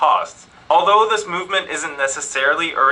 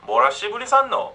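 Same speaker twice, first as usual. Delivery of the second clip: Gyeongsang dialect; 5 semitones higher, much faster than usual. Shown as a demonstration. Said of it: "it's used like".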